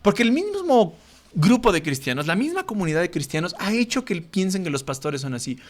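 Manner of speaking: ordinary talk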